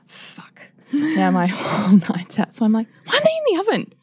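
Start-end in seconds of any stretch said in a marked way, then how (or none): 0.1-0.6 barely audible swear under the breath; whispered curse. Retold or imitated. Silent thought of dismay voiced. 3.0-3.9 much higher, exasperated demand; confronting entrance. Retold or imitated. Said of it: "that's how I enter".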